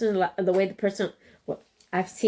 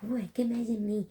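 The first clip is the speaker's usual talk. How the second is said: whispering; 12 dB quieter than usual. Pretend. Daydreaming about a hunk.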